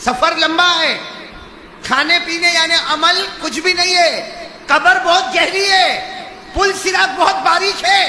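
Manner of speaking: high shouted list of warnings; fiery preaching. Acted. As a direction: cry out each phrase as a separate alarm. Preaches at full force. (گرجتی ہوئی تنبیہ)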